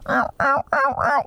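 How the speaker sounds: high squealing bugle call; elk bugle. Retold or imitated. Imitating calls while hunting.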